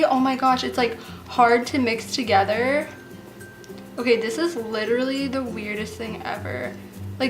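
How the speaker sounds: ordinary talk, nothing marked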